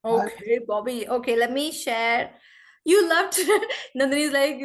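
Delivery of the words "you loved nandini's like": real laugh breaking through the words. Real, not acted. Teacher reads a student's praise aloud to the class, laughing.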